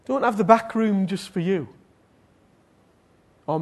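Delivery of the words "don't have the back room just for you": raised, pressing preacher's voice; high, emphatic exhortation; impassioned preaching. Real, not acted. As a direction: urge the congregation at full force. Preaches at full force.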